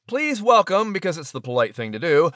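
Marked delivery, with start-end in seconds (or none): 0.1-0.9 raised announcer welcome; grand entrance announcement. Acted. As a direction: call it out like a showman.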